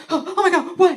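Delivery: high, loud startled gasp; freaked out. Retold or imitated. Husband jolted awake from nightmare, freaked out. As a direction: imitate waking in a panic. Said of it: "he seemed so freaked out".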